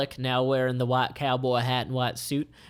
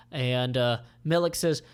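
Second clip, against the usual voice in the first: ordinary delivery, nothing marked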